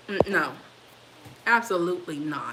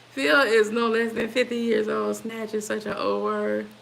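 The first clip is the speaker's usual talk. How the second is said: usual speaking voice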